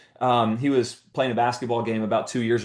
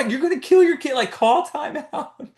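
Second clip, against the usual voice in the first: much higher, loud and outraged; yelling coach; 13 semitones higher, 3 dB louder than usual. Retold or imitated. Rival coach yells the boy is being pushed too far, high and loud. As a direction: holler it in disbelief. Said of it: "starts yelling at me".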